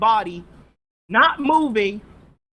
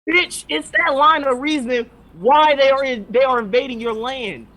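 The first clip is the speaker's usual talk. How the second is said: raised, heated voice at a foolish character; angry rant; 3 semitones higher, 5 dB louder than usual. Real, not acted. Ranting at Gabby about the invasion, angry and loud. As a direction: snap at her for her own logic.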